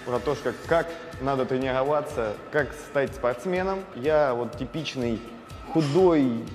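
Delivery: ordinary delivery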